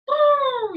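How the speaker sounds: high loud mock trumpeting; hammed-up elephant call. Shown as a demonstration. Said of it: "And then we'll see an elephant".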